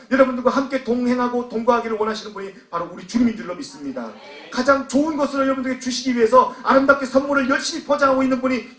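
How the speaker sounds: high, loud, driving preacher's delivery; impassioned preaching. Real, not acted. Preacher pressing the congregation about faded first love, impassioned. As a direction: preach it out at full force.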